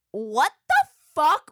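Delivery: loud, broken-up 'what the... fuck'; stunned disbelief. Retold or imitated. Woman retelling the nunchuck sight to host, in loud, stunned disbelief. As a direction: stop mid-phrase, then hit it high and loud. Acted shock at naked man.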